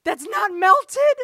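high, shouted mock despair; exasperated mother. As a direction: wail it like a mother at her wits' end. Dali's mother exasperated with his art.

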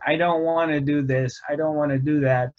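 normal talking voice

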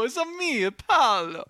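high, loud cartoon Italian Mario voice; "It's a me" in a hammed-up Italian Mario accent; mock Italian. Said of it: "Jared Leto doesn't go".

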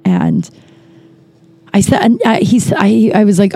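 ordinary talk

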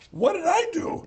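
high-pitched, incredulous plea; retold protest, voice shooting way up; indignant protest. Retells protesting at accusing emails.

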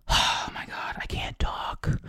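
breathless whispered aside; whispering. Real, not acted. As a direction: drop to a whisper, slow. Whispered aside after burping.

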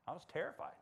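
ordinary talk

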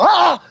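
retold high yelp of fright; imitating his own jumpy scream; a quick high yelp, replayed for laughs; startled yelp. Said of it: "they would rub the back of my ear and I'd go".

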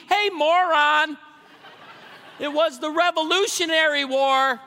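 high, loud, exasperated rebuke; mock indignant shout. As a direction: yell it like a fed-up congregant. Voicing angry churchgoers confronting him.

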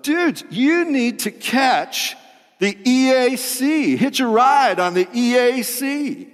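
half-done stoner sea turtle voice, stretched out; Crush impression. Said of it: "I'm not gonna do my full Crush impersonation".

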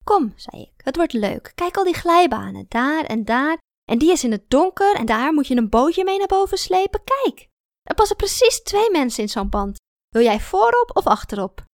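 high, loud, lively enthusiasm; eager coaxing. Acted. Woman coaxing a sulky girl onto the slides, eager and excited. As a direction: drum up excitement about the slides.

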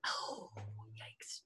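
breathy hushed yikes; whisper. Real, not acted. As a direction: whisper it in shock. Sudden hushed reaction.

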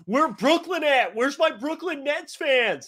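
high, slow, stretched hype call to the crowd; imitating an arena announcer begging for fans; arena hype man. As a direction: call out to an empty arena like a hyped MC.

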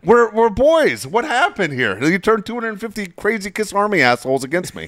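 high, loud mock outrage, slowing at "We're boys"; retold angry protest. Jeff yelling back on the phone, high mock indignation. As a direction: argue back, indignant and wound up.

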